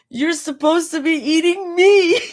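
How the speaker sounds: high, loud, teary whine; fake-cry the words; wobbly pout. A narrator's reading. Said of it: "her bottom lip wobbling".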